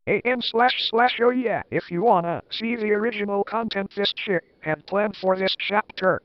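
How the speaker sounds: ordinary delivery, nothing marked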